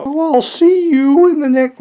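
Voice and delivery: high-pitched wrestler impression; Mankind voice. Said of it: "that's my Mankind voice".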